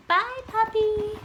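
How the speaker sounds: high, loud singsong goodbye; pet-talk farewell. Real, not acted. Owner saying bye to her puppy, singsong cooing.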